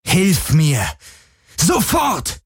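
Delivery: high, furious commanding voice building to a shout. Acted. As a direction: command it in rage. Commanding the helix in rage.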